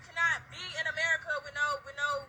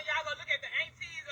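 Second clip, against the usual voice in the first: normal talking voice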